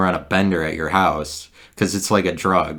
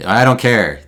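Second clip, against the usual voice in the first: defiant; 4 semitones higher, 7 dB louder than usual. Retold or imitated. Defiant outburst.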